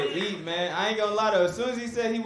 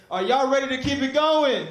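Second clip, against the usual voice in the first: loud, higher call to the crowd; hype host call; 6 semitones higher, 6 dB louder than usual. Real, not acted. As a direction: pump the room up.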